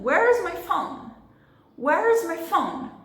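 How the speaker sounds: Where's high and drawn out, phone strong, my squeezed small; slow demo question with high, stressed Where's and a reduced my. Shown as a demonstration. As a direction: punch Where's and phone, shrink the "is" and my. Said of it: "Cadê o is e cadê o my".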